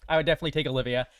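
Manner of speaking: normal talking voice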